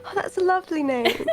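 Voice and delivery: pleased and polite; delighted Neff. Acted. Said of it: "Neff's gonna go".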